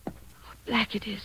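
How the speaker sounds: whispered, slow and awed in the dark; hushed whisper. Acted. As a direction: breathe it out, afraid of the dark.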